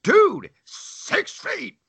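high, drawn-out mock yell; loud. Traveler telling the man to back off, loud. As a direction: call it out high, stretching each word. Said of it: "I was loud".

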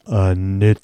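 low, long-drawn intoned call; Goenka. Retold or imitated. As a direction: intone ANITYA, stretching each syllable out low. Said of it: "He had a low voice".

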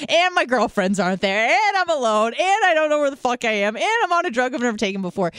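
retold panic, pitch jumping up as worries pile up; mounting alarm. Woman recounting being alone on an unknown drug, mounting alarm. Retelling her rising alarm when stranded high.

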